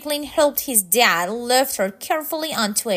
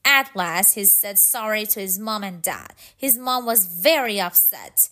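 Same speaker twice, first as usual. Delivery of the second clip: ordinary delivery, nothing marked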